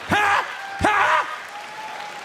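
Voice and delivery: mock outraged rant. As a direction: roar the punchline like a crazed old man. Staged mock rant punchline.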